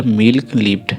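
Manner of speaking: usual speaking voice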